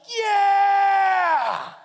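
long, high, loud yell of joy; triumphant shout. Retold or imitated. Daughter surfacing from the water, yelling with joy. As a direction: whoop after the jump.

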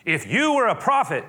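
loud, high taunting exclamation; host openly challenging the guest; mock outburst. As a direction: blurt it out loud to his face. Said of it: "He doesn't go".

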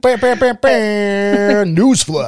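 loud, high newsflash fanfare voice; mock news jingle. Co-host hypes the quote unquote news, loud mock newsflash. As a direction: belt it out like a breaking news sting.

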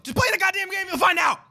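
shouting. Real, not acted. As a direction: shout it out in frustration. Frustrated outburst at friend.

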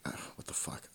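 voiceless groan of disbelief; retold whispered despair; whispered frustration. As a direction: breathe it out like you can't believe it.

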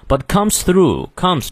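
"comes" strong after a light "but"; stress on COMES. Shown as a demonstration. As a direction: lean on "comes" in "but comes through". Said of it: "comes重读". (“comes”重读)

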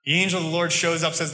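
ordinary talk

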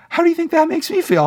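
very high, loud excited question; overjoyed, pitch leaping way up; fired up. Real, not acted. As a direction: burst out with rising excitement. Fired up by viewer's praise.

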